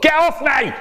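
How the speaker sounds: very high, alarmed shout; acted yell to fend someone off. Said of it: "I shouted out".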